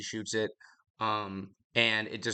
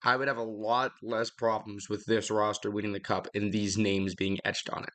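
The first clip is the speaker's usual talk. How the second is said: ordinary talk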